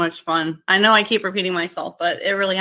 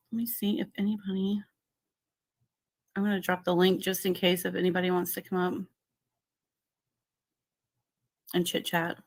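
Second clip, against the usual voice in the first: much softer, half to herself while busy; muttering to herself; 10 dB quieter than usual. Real, not acted. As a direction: mumble it aside, away from the mic. Fiddles with link while streaming.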